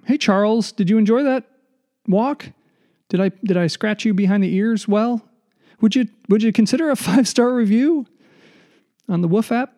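high, cooing dog talk; baby talk to dog. Retold or imitated. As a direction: coo at your dog. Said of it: "you're walking your dog".

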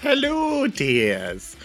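high-pitched, muddled Scottish Mrs Doubtfire greeting; hammed-up Scottish accent, swooping up high on the hello; bad Scottish accent. Guest greeting the podcast hosts, comically bad Scottish accent. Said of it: "That's my Scottish accent".

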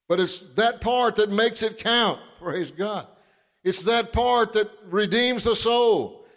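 high, fervent, slow pulpit preaching. Acted. Pastor preaching to his congregation about the blood, high and fervent. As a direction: stretch the words out, preach with fire.

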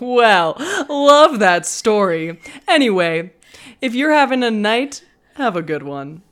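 loud, high, put-on presenter voice; broadcast sign-off. Shown as a demonstration. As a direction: sign off big, like a hammed-up host. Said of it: "I guess that's my sign off".

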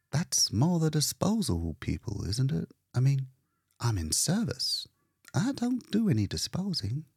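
a touch defensive, keeping it polite; Leora's voice. Acted. Leora answering painter.

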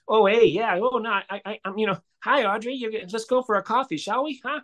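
mock Canadian accent. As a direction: parody a folksy Canadian accent. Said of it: "he would not sound like".

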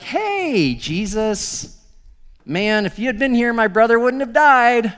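breezy "Hey" jumping high, offhand "man"; mock casual. Shown as a demonstration. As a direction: toss it off like it's no big deal. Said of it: "I don't think that Martha walked over and was like".

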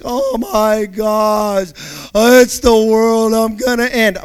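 loud, stretched, hammed-up panic; mock doom panic. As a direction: ham up someone sure the world is ending.

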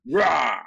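loud flexing grunt; short macho roar; gym bro roar. Retold or imitated.

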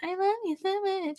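high, soft, quick mock swoon; gushing lover. Host as Layla hugging Spock, high soft gushing. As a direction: squeak out hammed-up adoration, softly.

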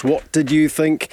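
ordinary talk

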